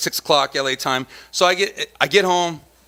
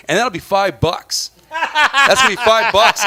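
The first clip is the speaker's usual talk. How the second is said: slow, louder, stretched-out five bucks; mock drawn-out fee; 5 semitones higher, 5 dB louder, slower than usual. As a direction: drag out the fee like it's a punchline. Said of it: "she goes".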